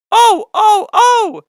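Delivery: alarmed cry. A narrator's reading. Henry crying out as stones hit him, alarmed. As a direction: yelp in shock.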